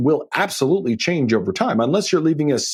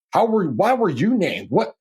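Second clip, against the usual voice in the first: mock-indignant challenge, pitch swinging up; lightly acted disbelief from jealous siblings; 6 semitones higher than usual. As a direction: imitate put-out siblings questioning a choice. Resentful siblings challenge the choice.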